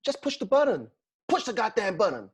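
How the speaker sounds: put-on American accent. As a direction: bark it like an American action hero. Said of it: "speak in an American accent".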